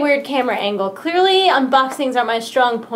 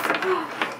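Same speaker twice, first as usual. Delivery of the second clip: quiet gasp; 4 semitones higher, 8 dB quieter than usual. Real, not acted. Gasp at headphone port.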